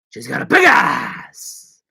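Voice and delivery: slow build to a loud bellow; hammy movie-star impression, stretching the last words; Al Pacino. Shown as a demonstration. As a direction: draw it out and explode at the end.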